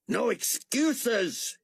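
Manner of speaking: slow, high shouted order; panicked slow shout; crying out. A narrator's reading. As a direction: cry it out desperately. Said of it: "he cried".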